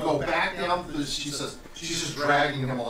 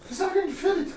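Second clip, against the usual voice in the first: fussy tailor. Acted. Tailor Friedrich fussing.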